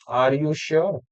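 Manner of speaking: "sure" said with a soft sh; quiet question stressing "sure" with sh; sh sound demo. Shown as a demonstration. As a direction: bring out the sh in "sure". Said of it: "प्रश्न पूछा मैंने".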